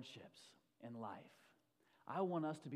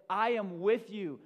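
higher, ringing-out declaration as Jesus; loud proclamation; 5 semitones higher, 13 dB louder than usual. Acted. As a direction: proclaim it loudly to a whole congregation. Preacher proclaims Jesus' words.

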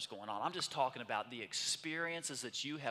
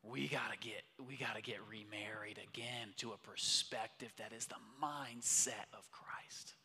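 hushed; 4 semitones lower, 6 dB quieter than usual. Real, not acted. Hushed sermon climax.

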